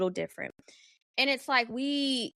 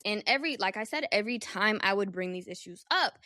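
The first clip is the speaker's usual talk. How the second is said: usual speaking voice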